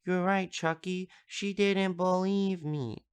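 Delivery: put-on high child voice, slowing at the end; imitated little boy. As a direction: imitate a small kid confiding in his doll. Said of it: "turns over to Chucky and goes".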